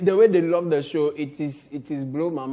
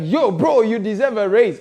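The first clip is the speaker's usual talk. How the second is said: louder, higher hyped-up bro voice; mock hype; 3 semitones higher, 8 dB louder than usual. Doctor fan praising the host, loud hyped greeting. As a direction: shout it like an excited fan. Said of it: "and it was like".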